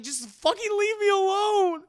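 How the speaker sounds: high, loud exasperated outburst; acted angry yell. Leia snapping at Han to go, furious. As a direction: yell to drive him away. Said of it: "I yelled at you".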